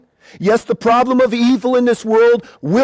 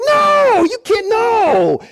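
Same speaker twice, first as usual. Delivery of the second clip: high emphatic no, slowing after; lady's refusal; 12 semitones higher, much slower than usual. Retold or imitated. Preacher voicing the lady, high firm no. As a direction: play the lady shutting it down.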